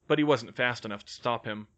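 plain reading voice

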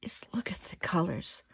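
hushed, whispered wonder; breathy whisper of delight; awed whisper. Real, not acted. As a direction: whisper it as if revealing a treasure. Awed showing of the pastel colors.

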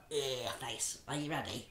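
plain reading voice

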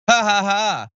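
sarcastic spoken laughter; mock laugh. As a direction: fake a laugh at a weak joke.

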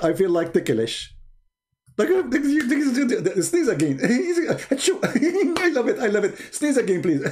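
a hint of a giggly, delighted god; mocking God's voice. Mocking God as he begs Adam to sneeze again, giggly. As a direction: play a god tickled by sneezes, laughing. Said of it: "Because I am Allah".

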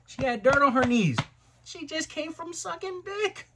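high, drawn-out mock outrage, swelling loud in bursts; incredulous outrage. Storyteller acting out his shock to G about the girl, incredulous. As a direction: wail it slowly, way up high, in disbelief.

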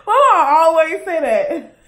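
loud laughing question. Real, not acted. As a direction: laugh through the words. Laughs at herself.

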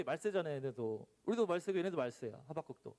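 ordinary delivery

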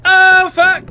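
high-pitched panicked wail; panicked squealing. Real, not acted. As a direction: squeal in terror as she closes in. Monster closing in, panicking.

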